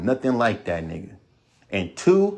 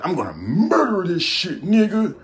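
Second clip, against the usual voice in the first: higher, pumped-up hype voice; hyped rapper; 7 semitones higher than usual. Retold or imitated. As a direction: hype it up like a rapper entering the booth. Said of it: "No one approaches a track like".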